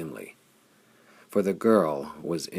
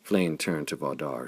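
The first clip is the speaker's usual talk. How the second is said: plain reading voice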